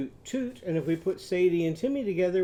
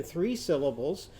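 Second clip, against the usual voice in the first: normal talking voice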